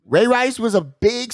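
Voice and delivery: normal talking voice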